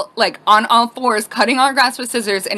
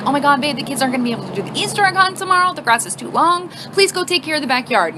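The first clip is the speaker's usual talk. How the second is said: higher-pitched, upset retold plea; emotional pleading; 4 semitones higher than usual. Wife to husband about the too-long grass, emotional pleading. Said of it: "all emotional".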